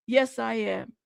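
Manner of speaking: ordinary delivery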